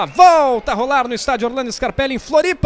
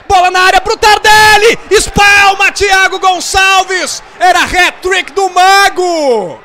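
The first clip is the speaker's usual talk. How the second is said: explosive goal commentary; 3 semitones higher, 5 dB louder than usual. Real, not acted. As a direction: build up the chance, shouting. Near-goal chance, rising shout.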